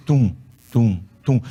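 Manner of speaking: low, deep thumping tum, tum, tum; heartbeat sound. Retold or imitated. Preacher telling the congregation about the ultrasound, low heartbeat imitation.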